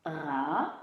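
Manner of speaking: "Radis" drawn out, long gap after "Ra"; syllable by syllable. Shown as a demonstration. Teacher sounding out a child's word, syllable by syllable. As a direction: stretch "Ra" upward, pause, then "dis".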